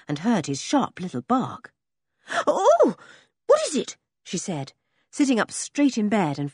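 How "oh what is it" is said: high, startled panic; panicked. Acted. Girl woken suddenly by the dog, high startled panic. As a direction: jolt awake in a fright. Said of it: "She was quite panic-stricken".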